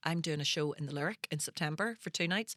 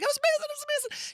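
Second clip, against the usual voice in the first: fast, much higher, breathless gushing; giddy gush; much faster than usual. Retold or imitated. Teen to her mum after the gig, fast giddy gush. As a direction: gush like a dazzled teenager.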